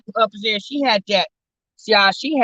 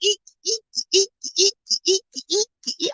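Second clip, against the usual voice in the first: much higher, thin eek-eek squeaks; squeaky bed imitation; 11 semitones higher, 3 dB quieter than usual. Imitating the upstairs bed squeaking to the audience, high squeaks. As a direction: copy the bedsprings going all night. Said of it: "that's all you heard".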